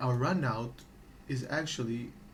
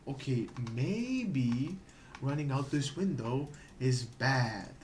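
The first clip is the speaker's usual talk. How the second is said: usual speaking voice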